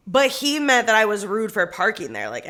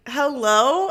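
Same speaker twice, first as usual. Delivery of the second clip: louder, higher acted-out phone greeting; indignant; 6 semitones higher, 7 dB louder than usual. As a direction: demand a response down the line. Indignant callback to rude landlord.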